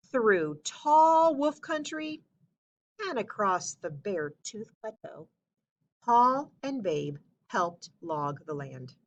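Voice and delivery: usual speaking voice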